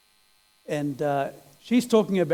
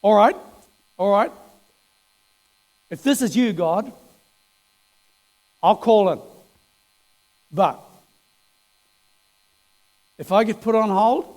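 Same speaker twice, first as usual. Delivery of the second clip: exasperated bargaining; 4 dB louder than usual. Retold or imitated. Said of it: "he gets to the point and he says".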